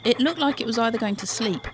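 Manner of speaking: ordinary delivery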